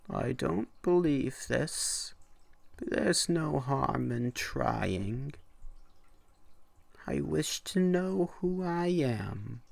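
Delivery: man making his wish, high and uncertain; doubtful old man, high voice. A narrator's reading. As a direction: sceptical yet hopeful old traveller.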